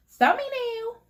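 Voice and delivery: high sung-out thumbnail; high playful call; thumbnail pose. Acted. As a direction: announce the pose brightly. Posing for thumbnail.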